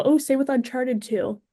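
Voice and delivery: ordinary delivery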